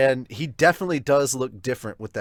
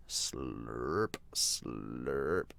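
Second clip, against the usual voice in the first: low, drawn-out mock slurp; slurping sound effect; 13 semitones lower, 13 dB quieter than usual. Reads the slurping sound effect aloud.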